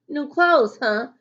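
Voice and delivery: high, loud taunting jab; smart-alecky. A narrator's reading. John John starts up with Malika, smart-alecky. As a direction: needle her like a cocky classmate. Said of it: "trying to be smart".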